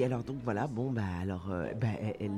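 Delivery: plain reading voice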